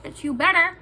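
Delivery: high, indignant warning; threatening retort. Retold or imitated. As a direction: act out warning her off. Acted threat to the girl.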